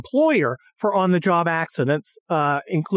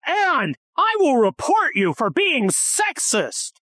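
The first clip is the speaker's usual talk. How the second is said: higher, slow mocking whine; mock prissy complaint; 7 semitones higher, slower than usual. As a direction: drag out a tattletale threat. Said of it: "She's like".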